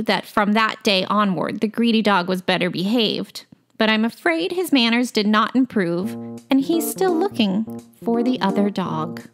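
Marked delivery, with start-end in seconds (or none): none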